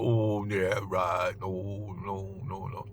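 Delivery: soft, dismissive "oh yeah, right, no no no"; fading mock scoff, trailing to a hush; sarcastic passenger. People doubting his tips, quiet mocking scoff.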